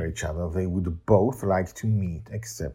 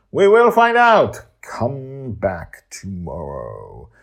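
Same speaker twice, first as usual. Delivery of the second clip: big, slow cliffhanger announcement, much higher and louder; dramatic teaser; 4 semitones higher, 11 dB louder, much slower than usual. Acted. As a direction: tease the next episode like a trailer voice. Cliffhanger teaser ending the episode.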